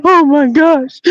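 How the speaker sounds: high, wailing imitation of a crying woman; mock crying. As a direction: wail it out in pretend tears. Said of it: "she's crying. She's like".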